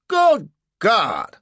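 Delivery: high, loud indignant exclamation; pompous shock; outraged judge. A narrator's reading. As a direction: splutter in outrage.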